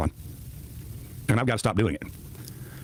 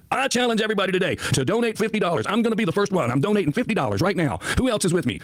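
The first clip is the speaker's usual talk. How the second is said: higher, eager mock rallying voice; imitating Bo's challenge; 7 semitones higher than usual. Host mocks a chatter rallying donations, higher mock voice. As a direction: parody a chatter whipping up donations. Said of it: "So what did Bo Sweeney do?".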